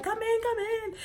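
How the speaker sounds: very high, gushing mock invitation; excited welcome. Grandmother welcomes long-lost grandchild in, high mock excitement. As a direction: imitate an overjoyed relative calling someone inside.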